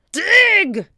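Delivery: very high, loud barked command; captain screaming an order; shouted order. A narrator's reading. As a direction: yell it at the crew. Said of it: "shouted Captain Bones".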